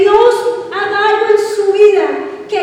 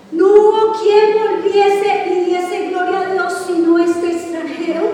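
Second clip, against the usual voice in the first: ordinary talk, nothing marked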